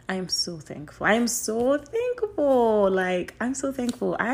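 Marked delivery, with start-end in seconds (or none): 1.1-2.9 higher, louder, slow and drawn out; fervent thanks. Real, not acted. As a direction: pour it out to God. Emphatic thanks to God.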